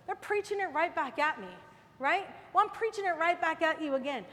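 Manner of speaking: usual speaking voice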